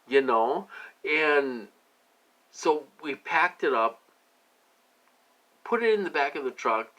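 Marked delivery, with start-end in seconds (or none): none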